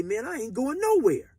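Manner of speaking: high and loud, standing his ground; defiant refusal. Retold or imitated. New inmate refusing to move for the gangster, defiant. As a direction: plant your feet and refuse. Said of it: "I didn't like the way he got at me".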